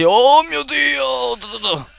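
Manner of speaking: strillo acuto e lunghissimo; grido di spavento. Real, not acted. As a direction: urla dallo spavento improvviso. Spavento improvviso in gioco. (strillo di spavento)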